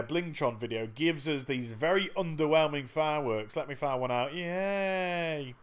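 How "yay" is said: long, drawn-out half-hearted yay; mock cheer. Player greeting very underwhelming fireworks, mock cheer. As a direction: cheer feebly on one held note.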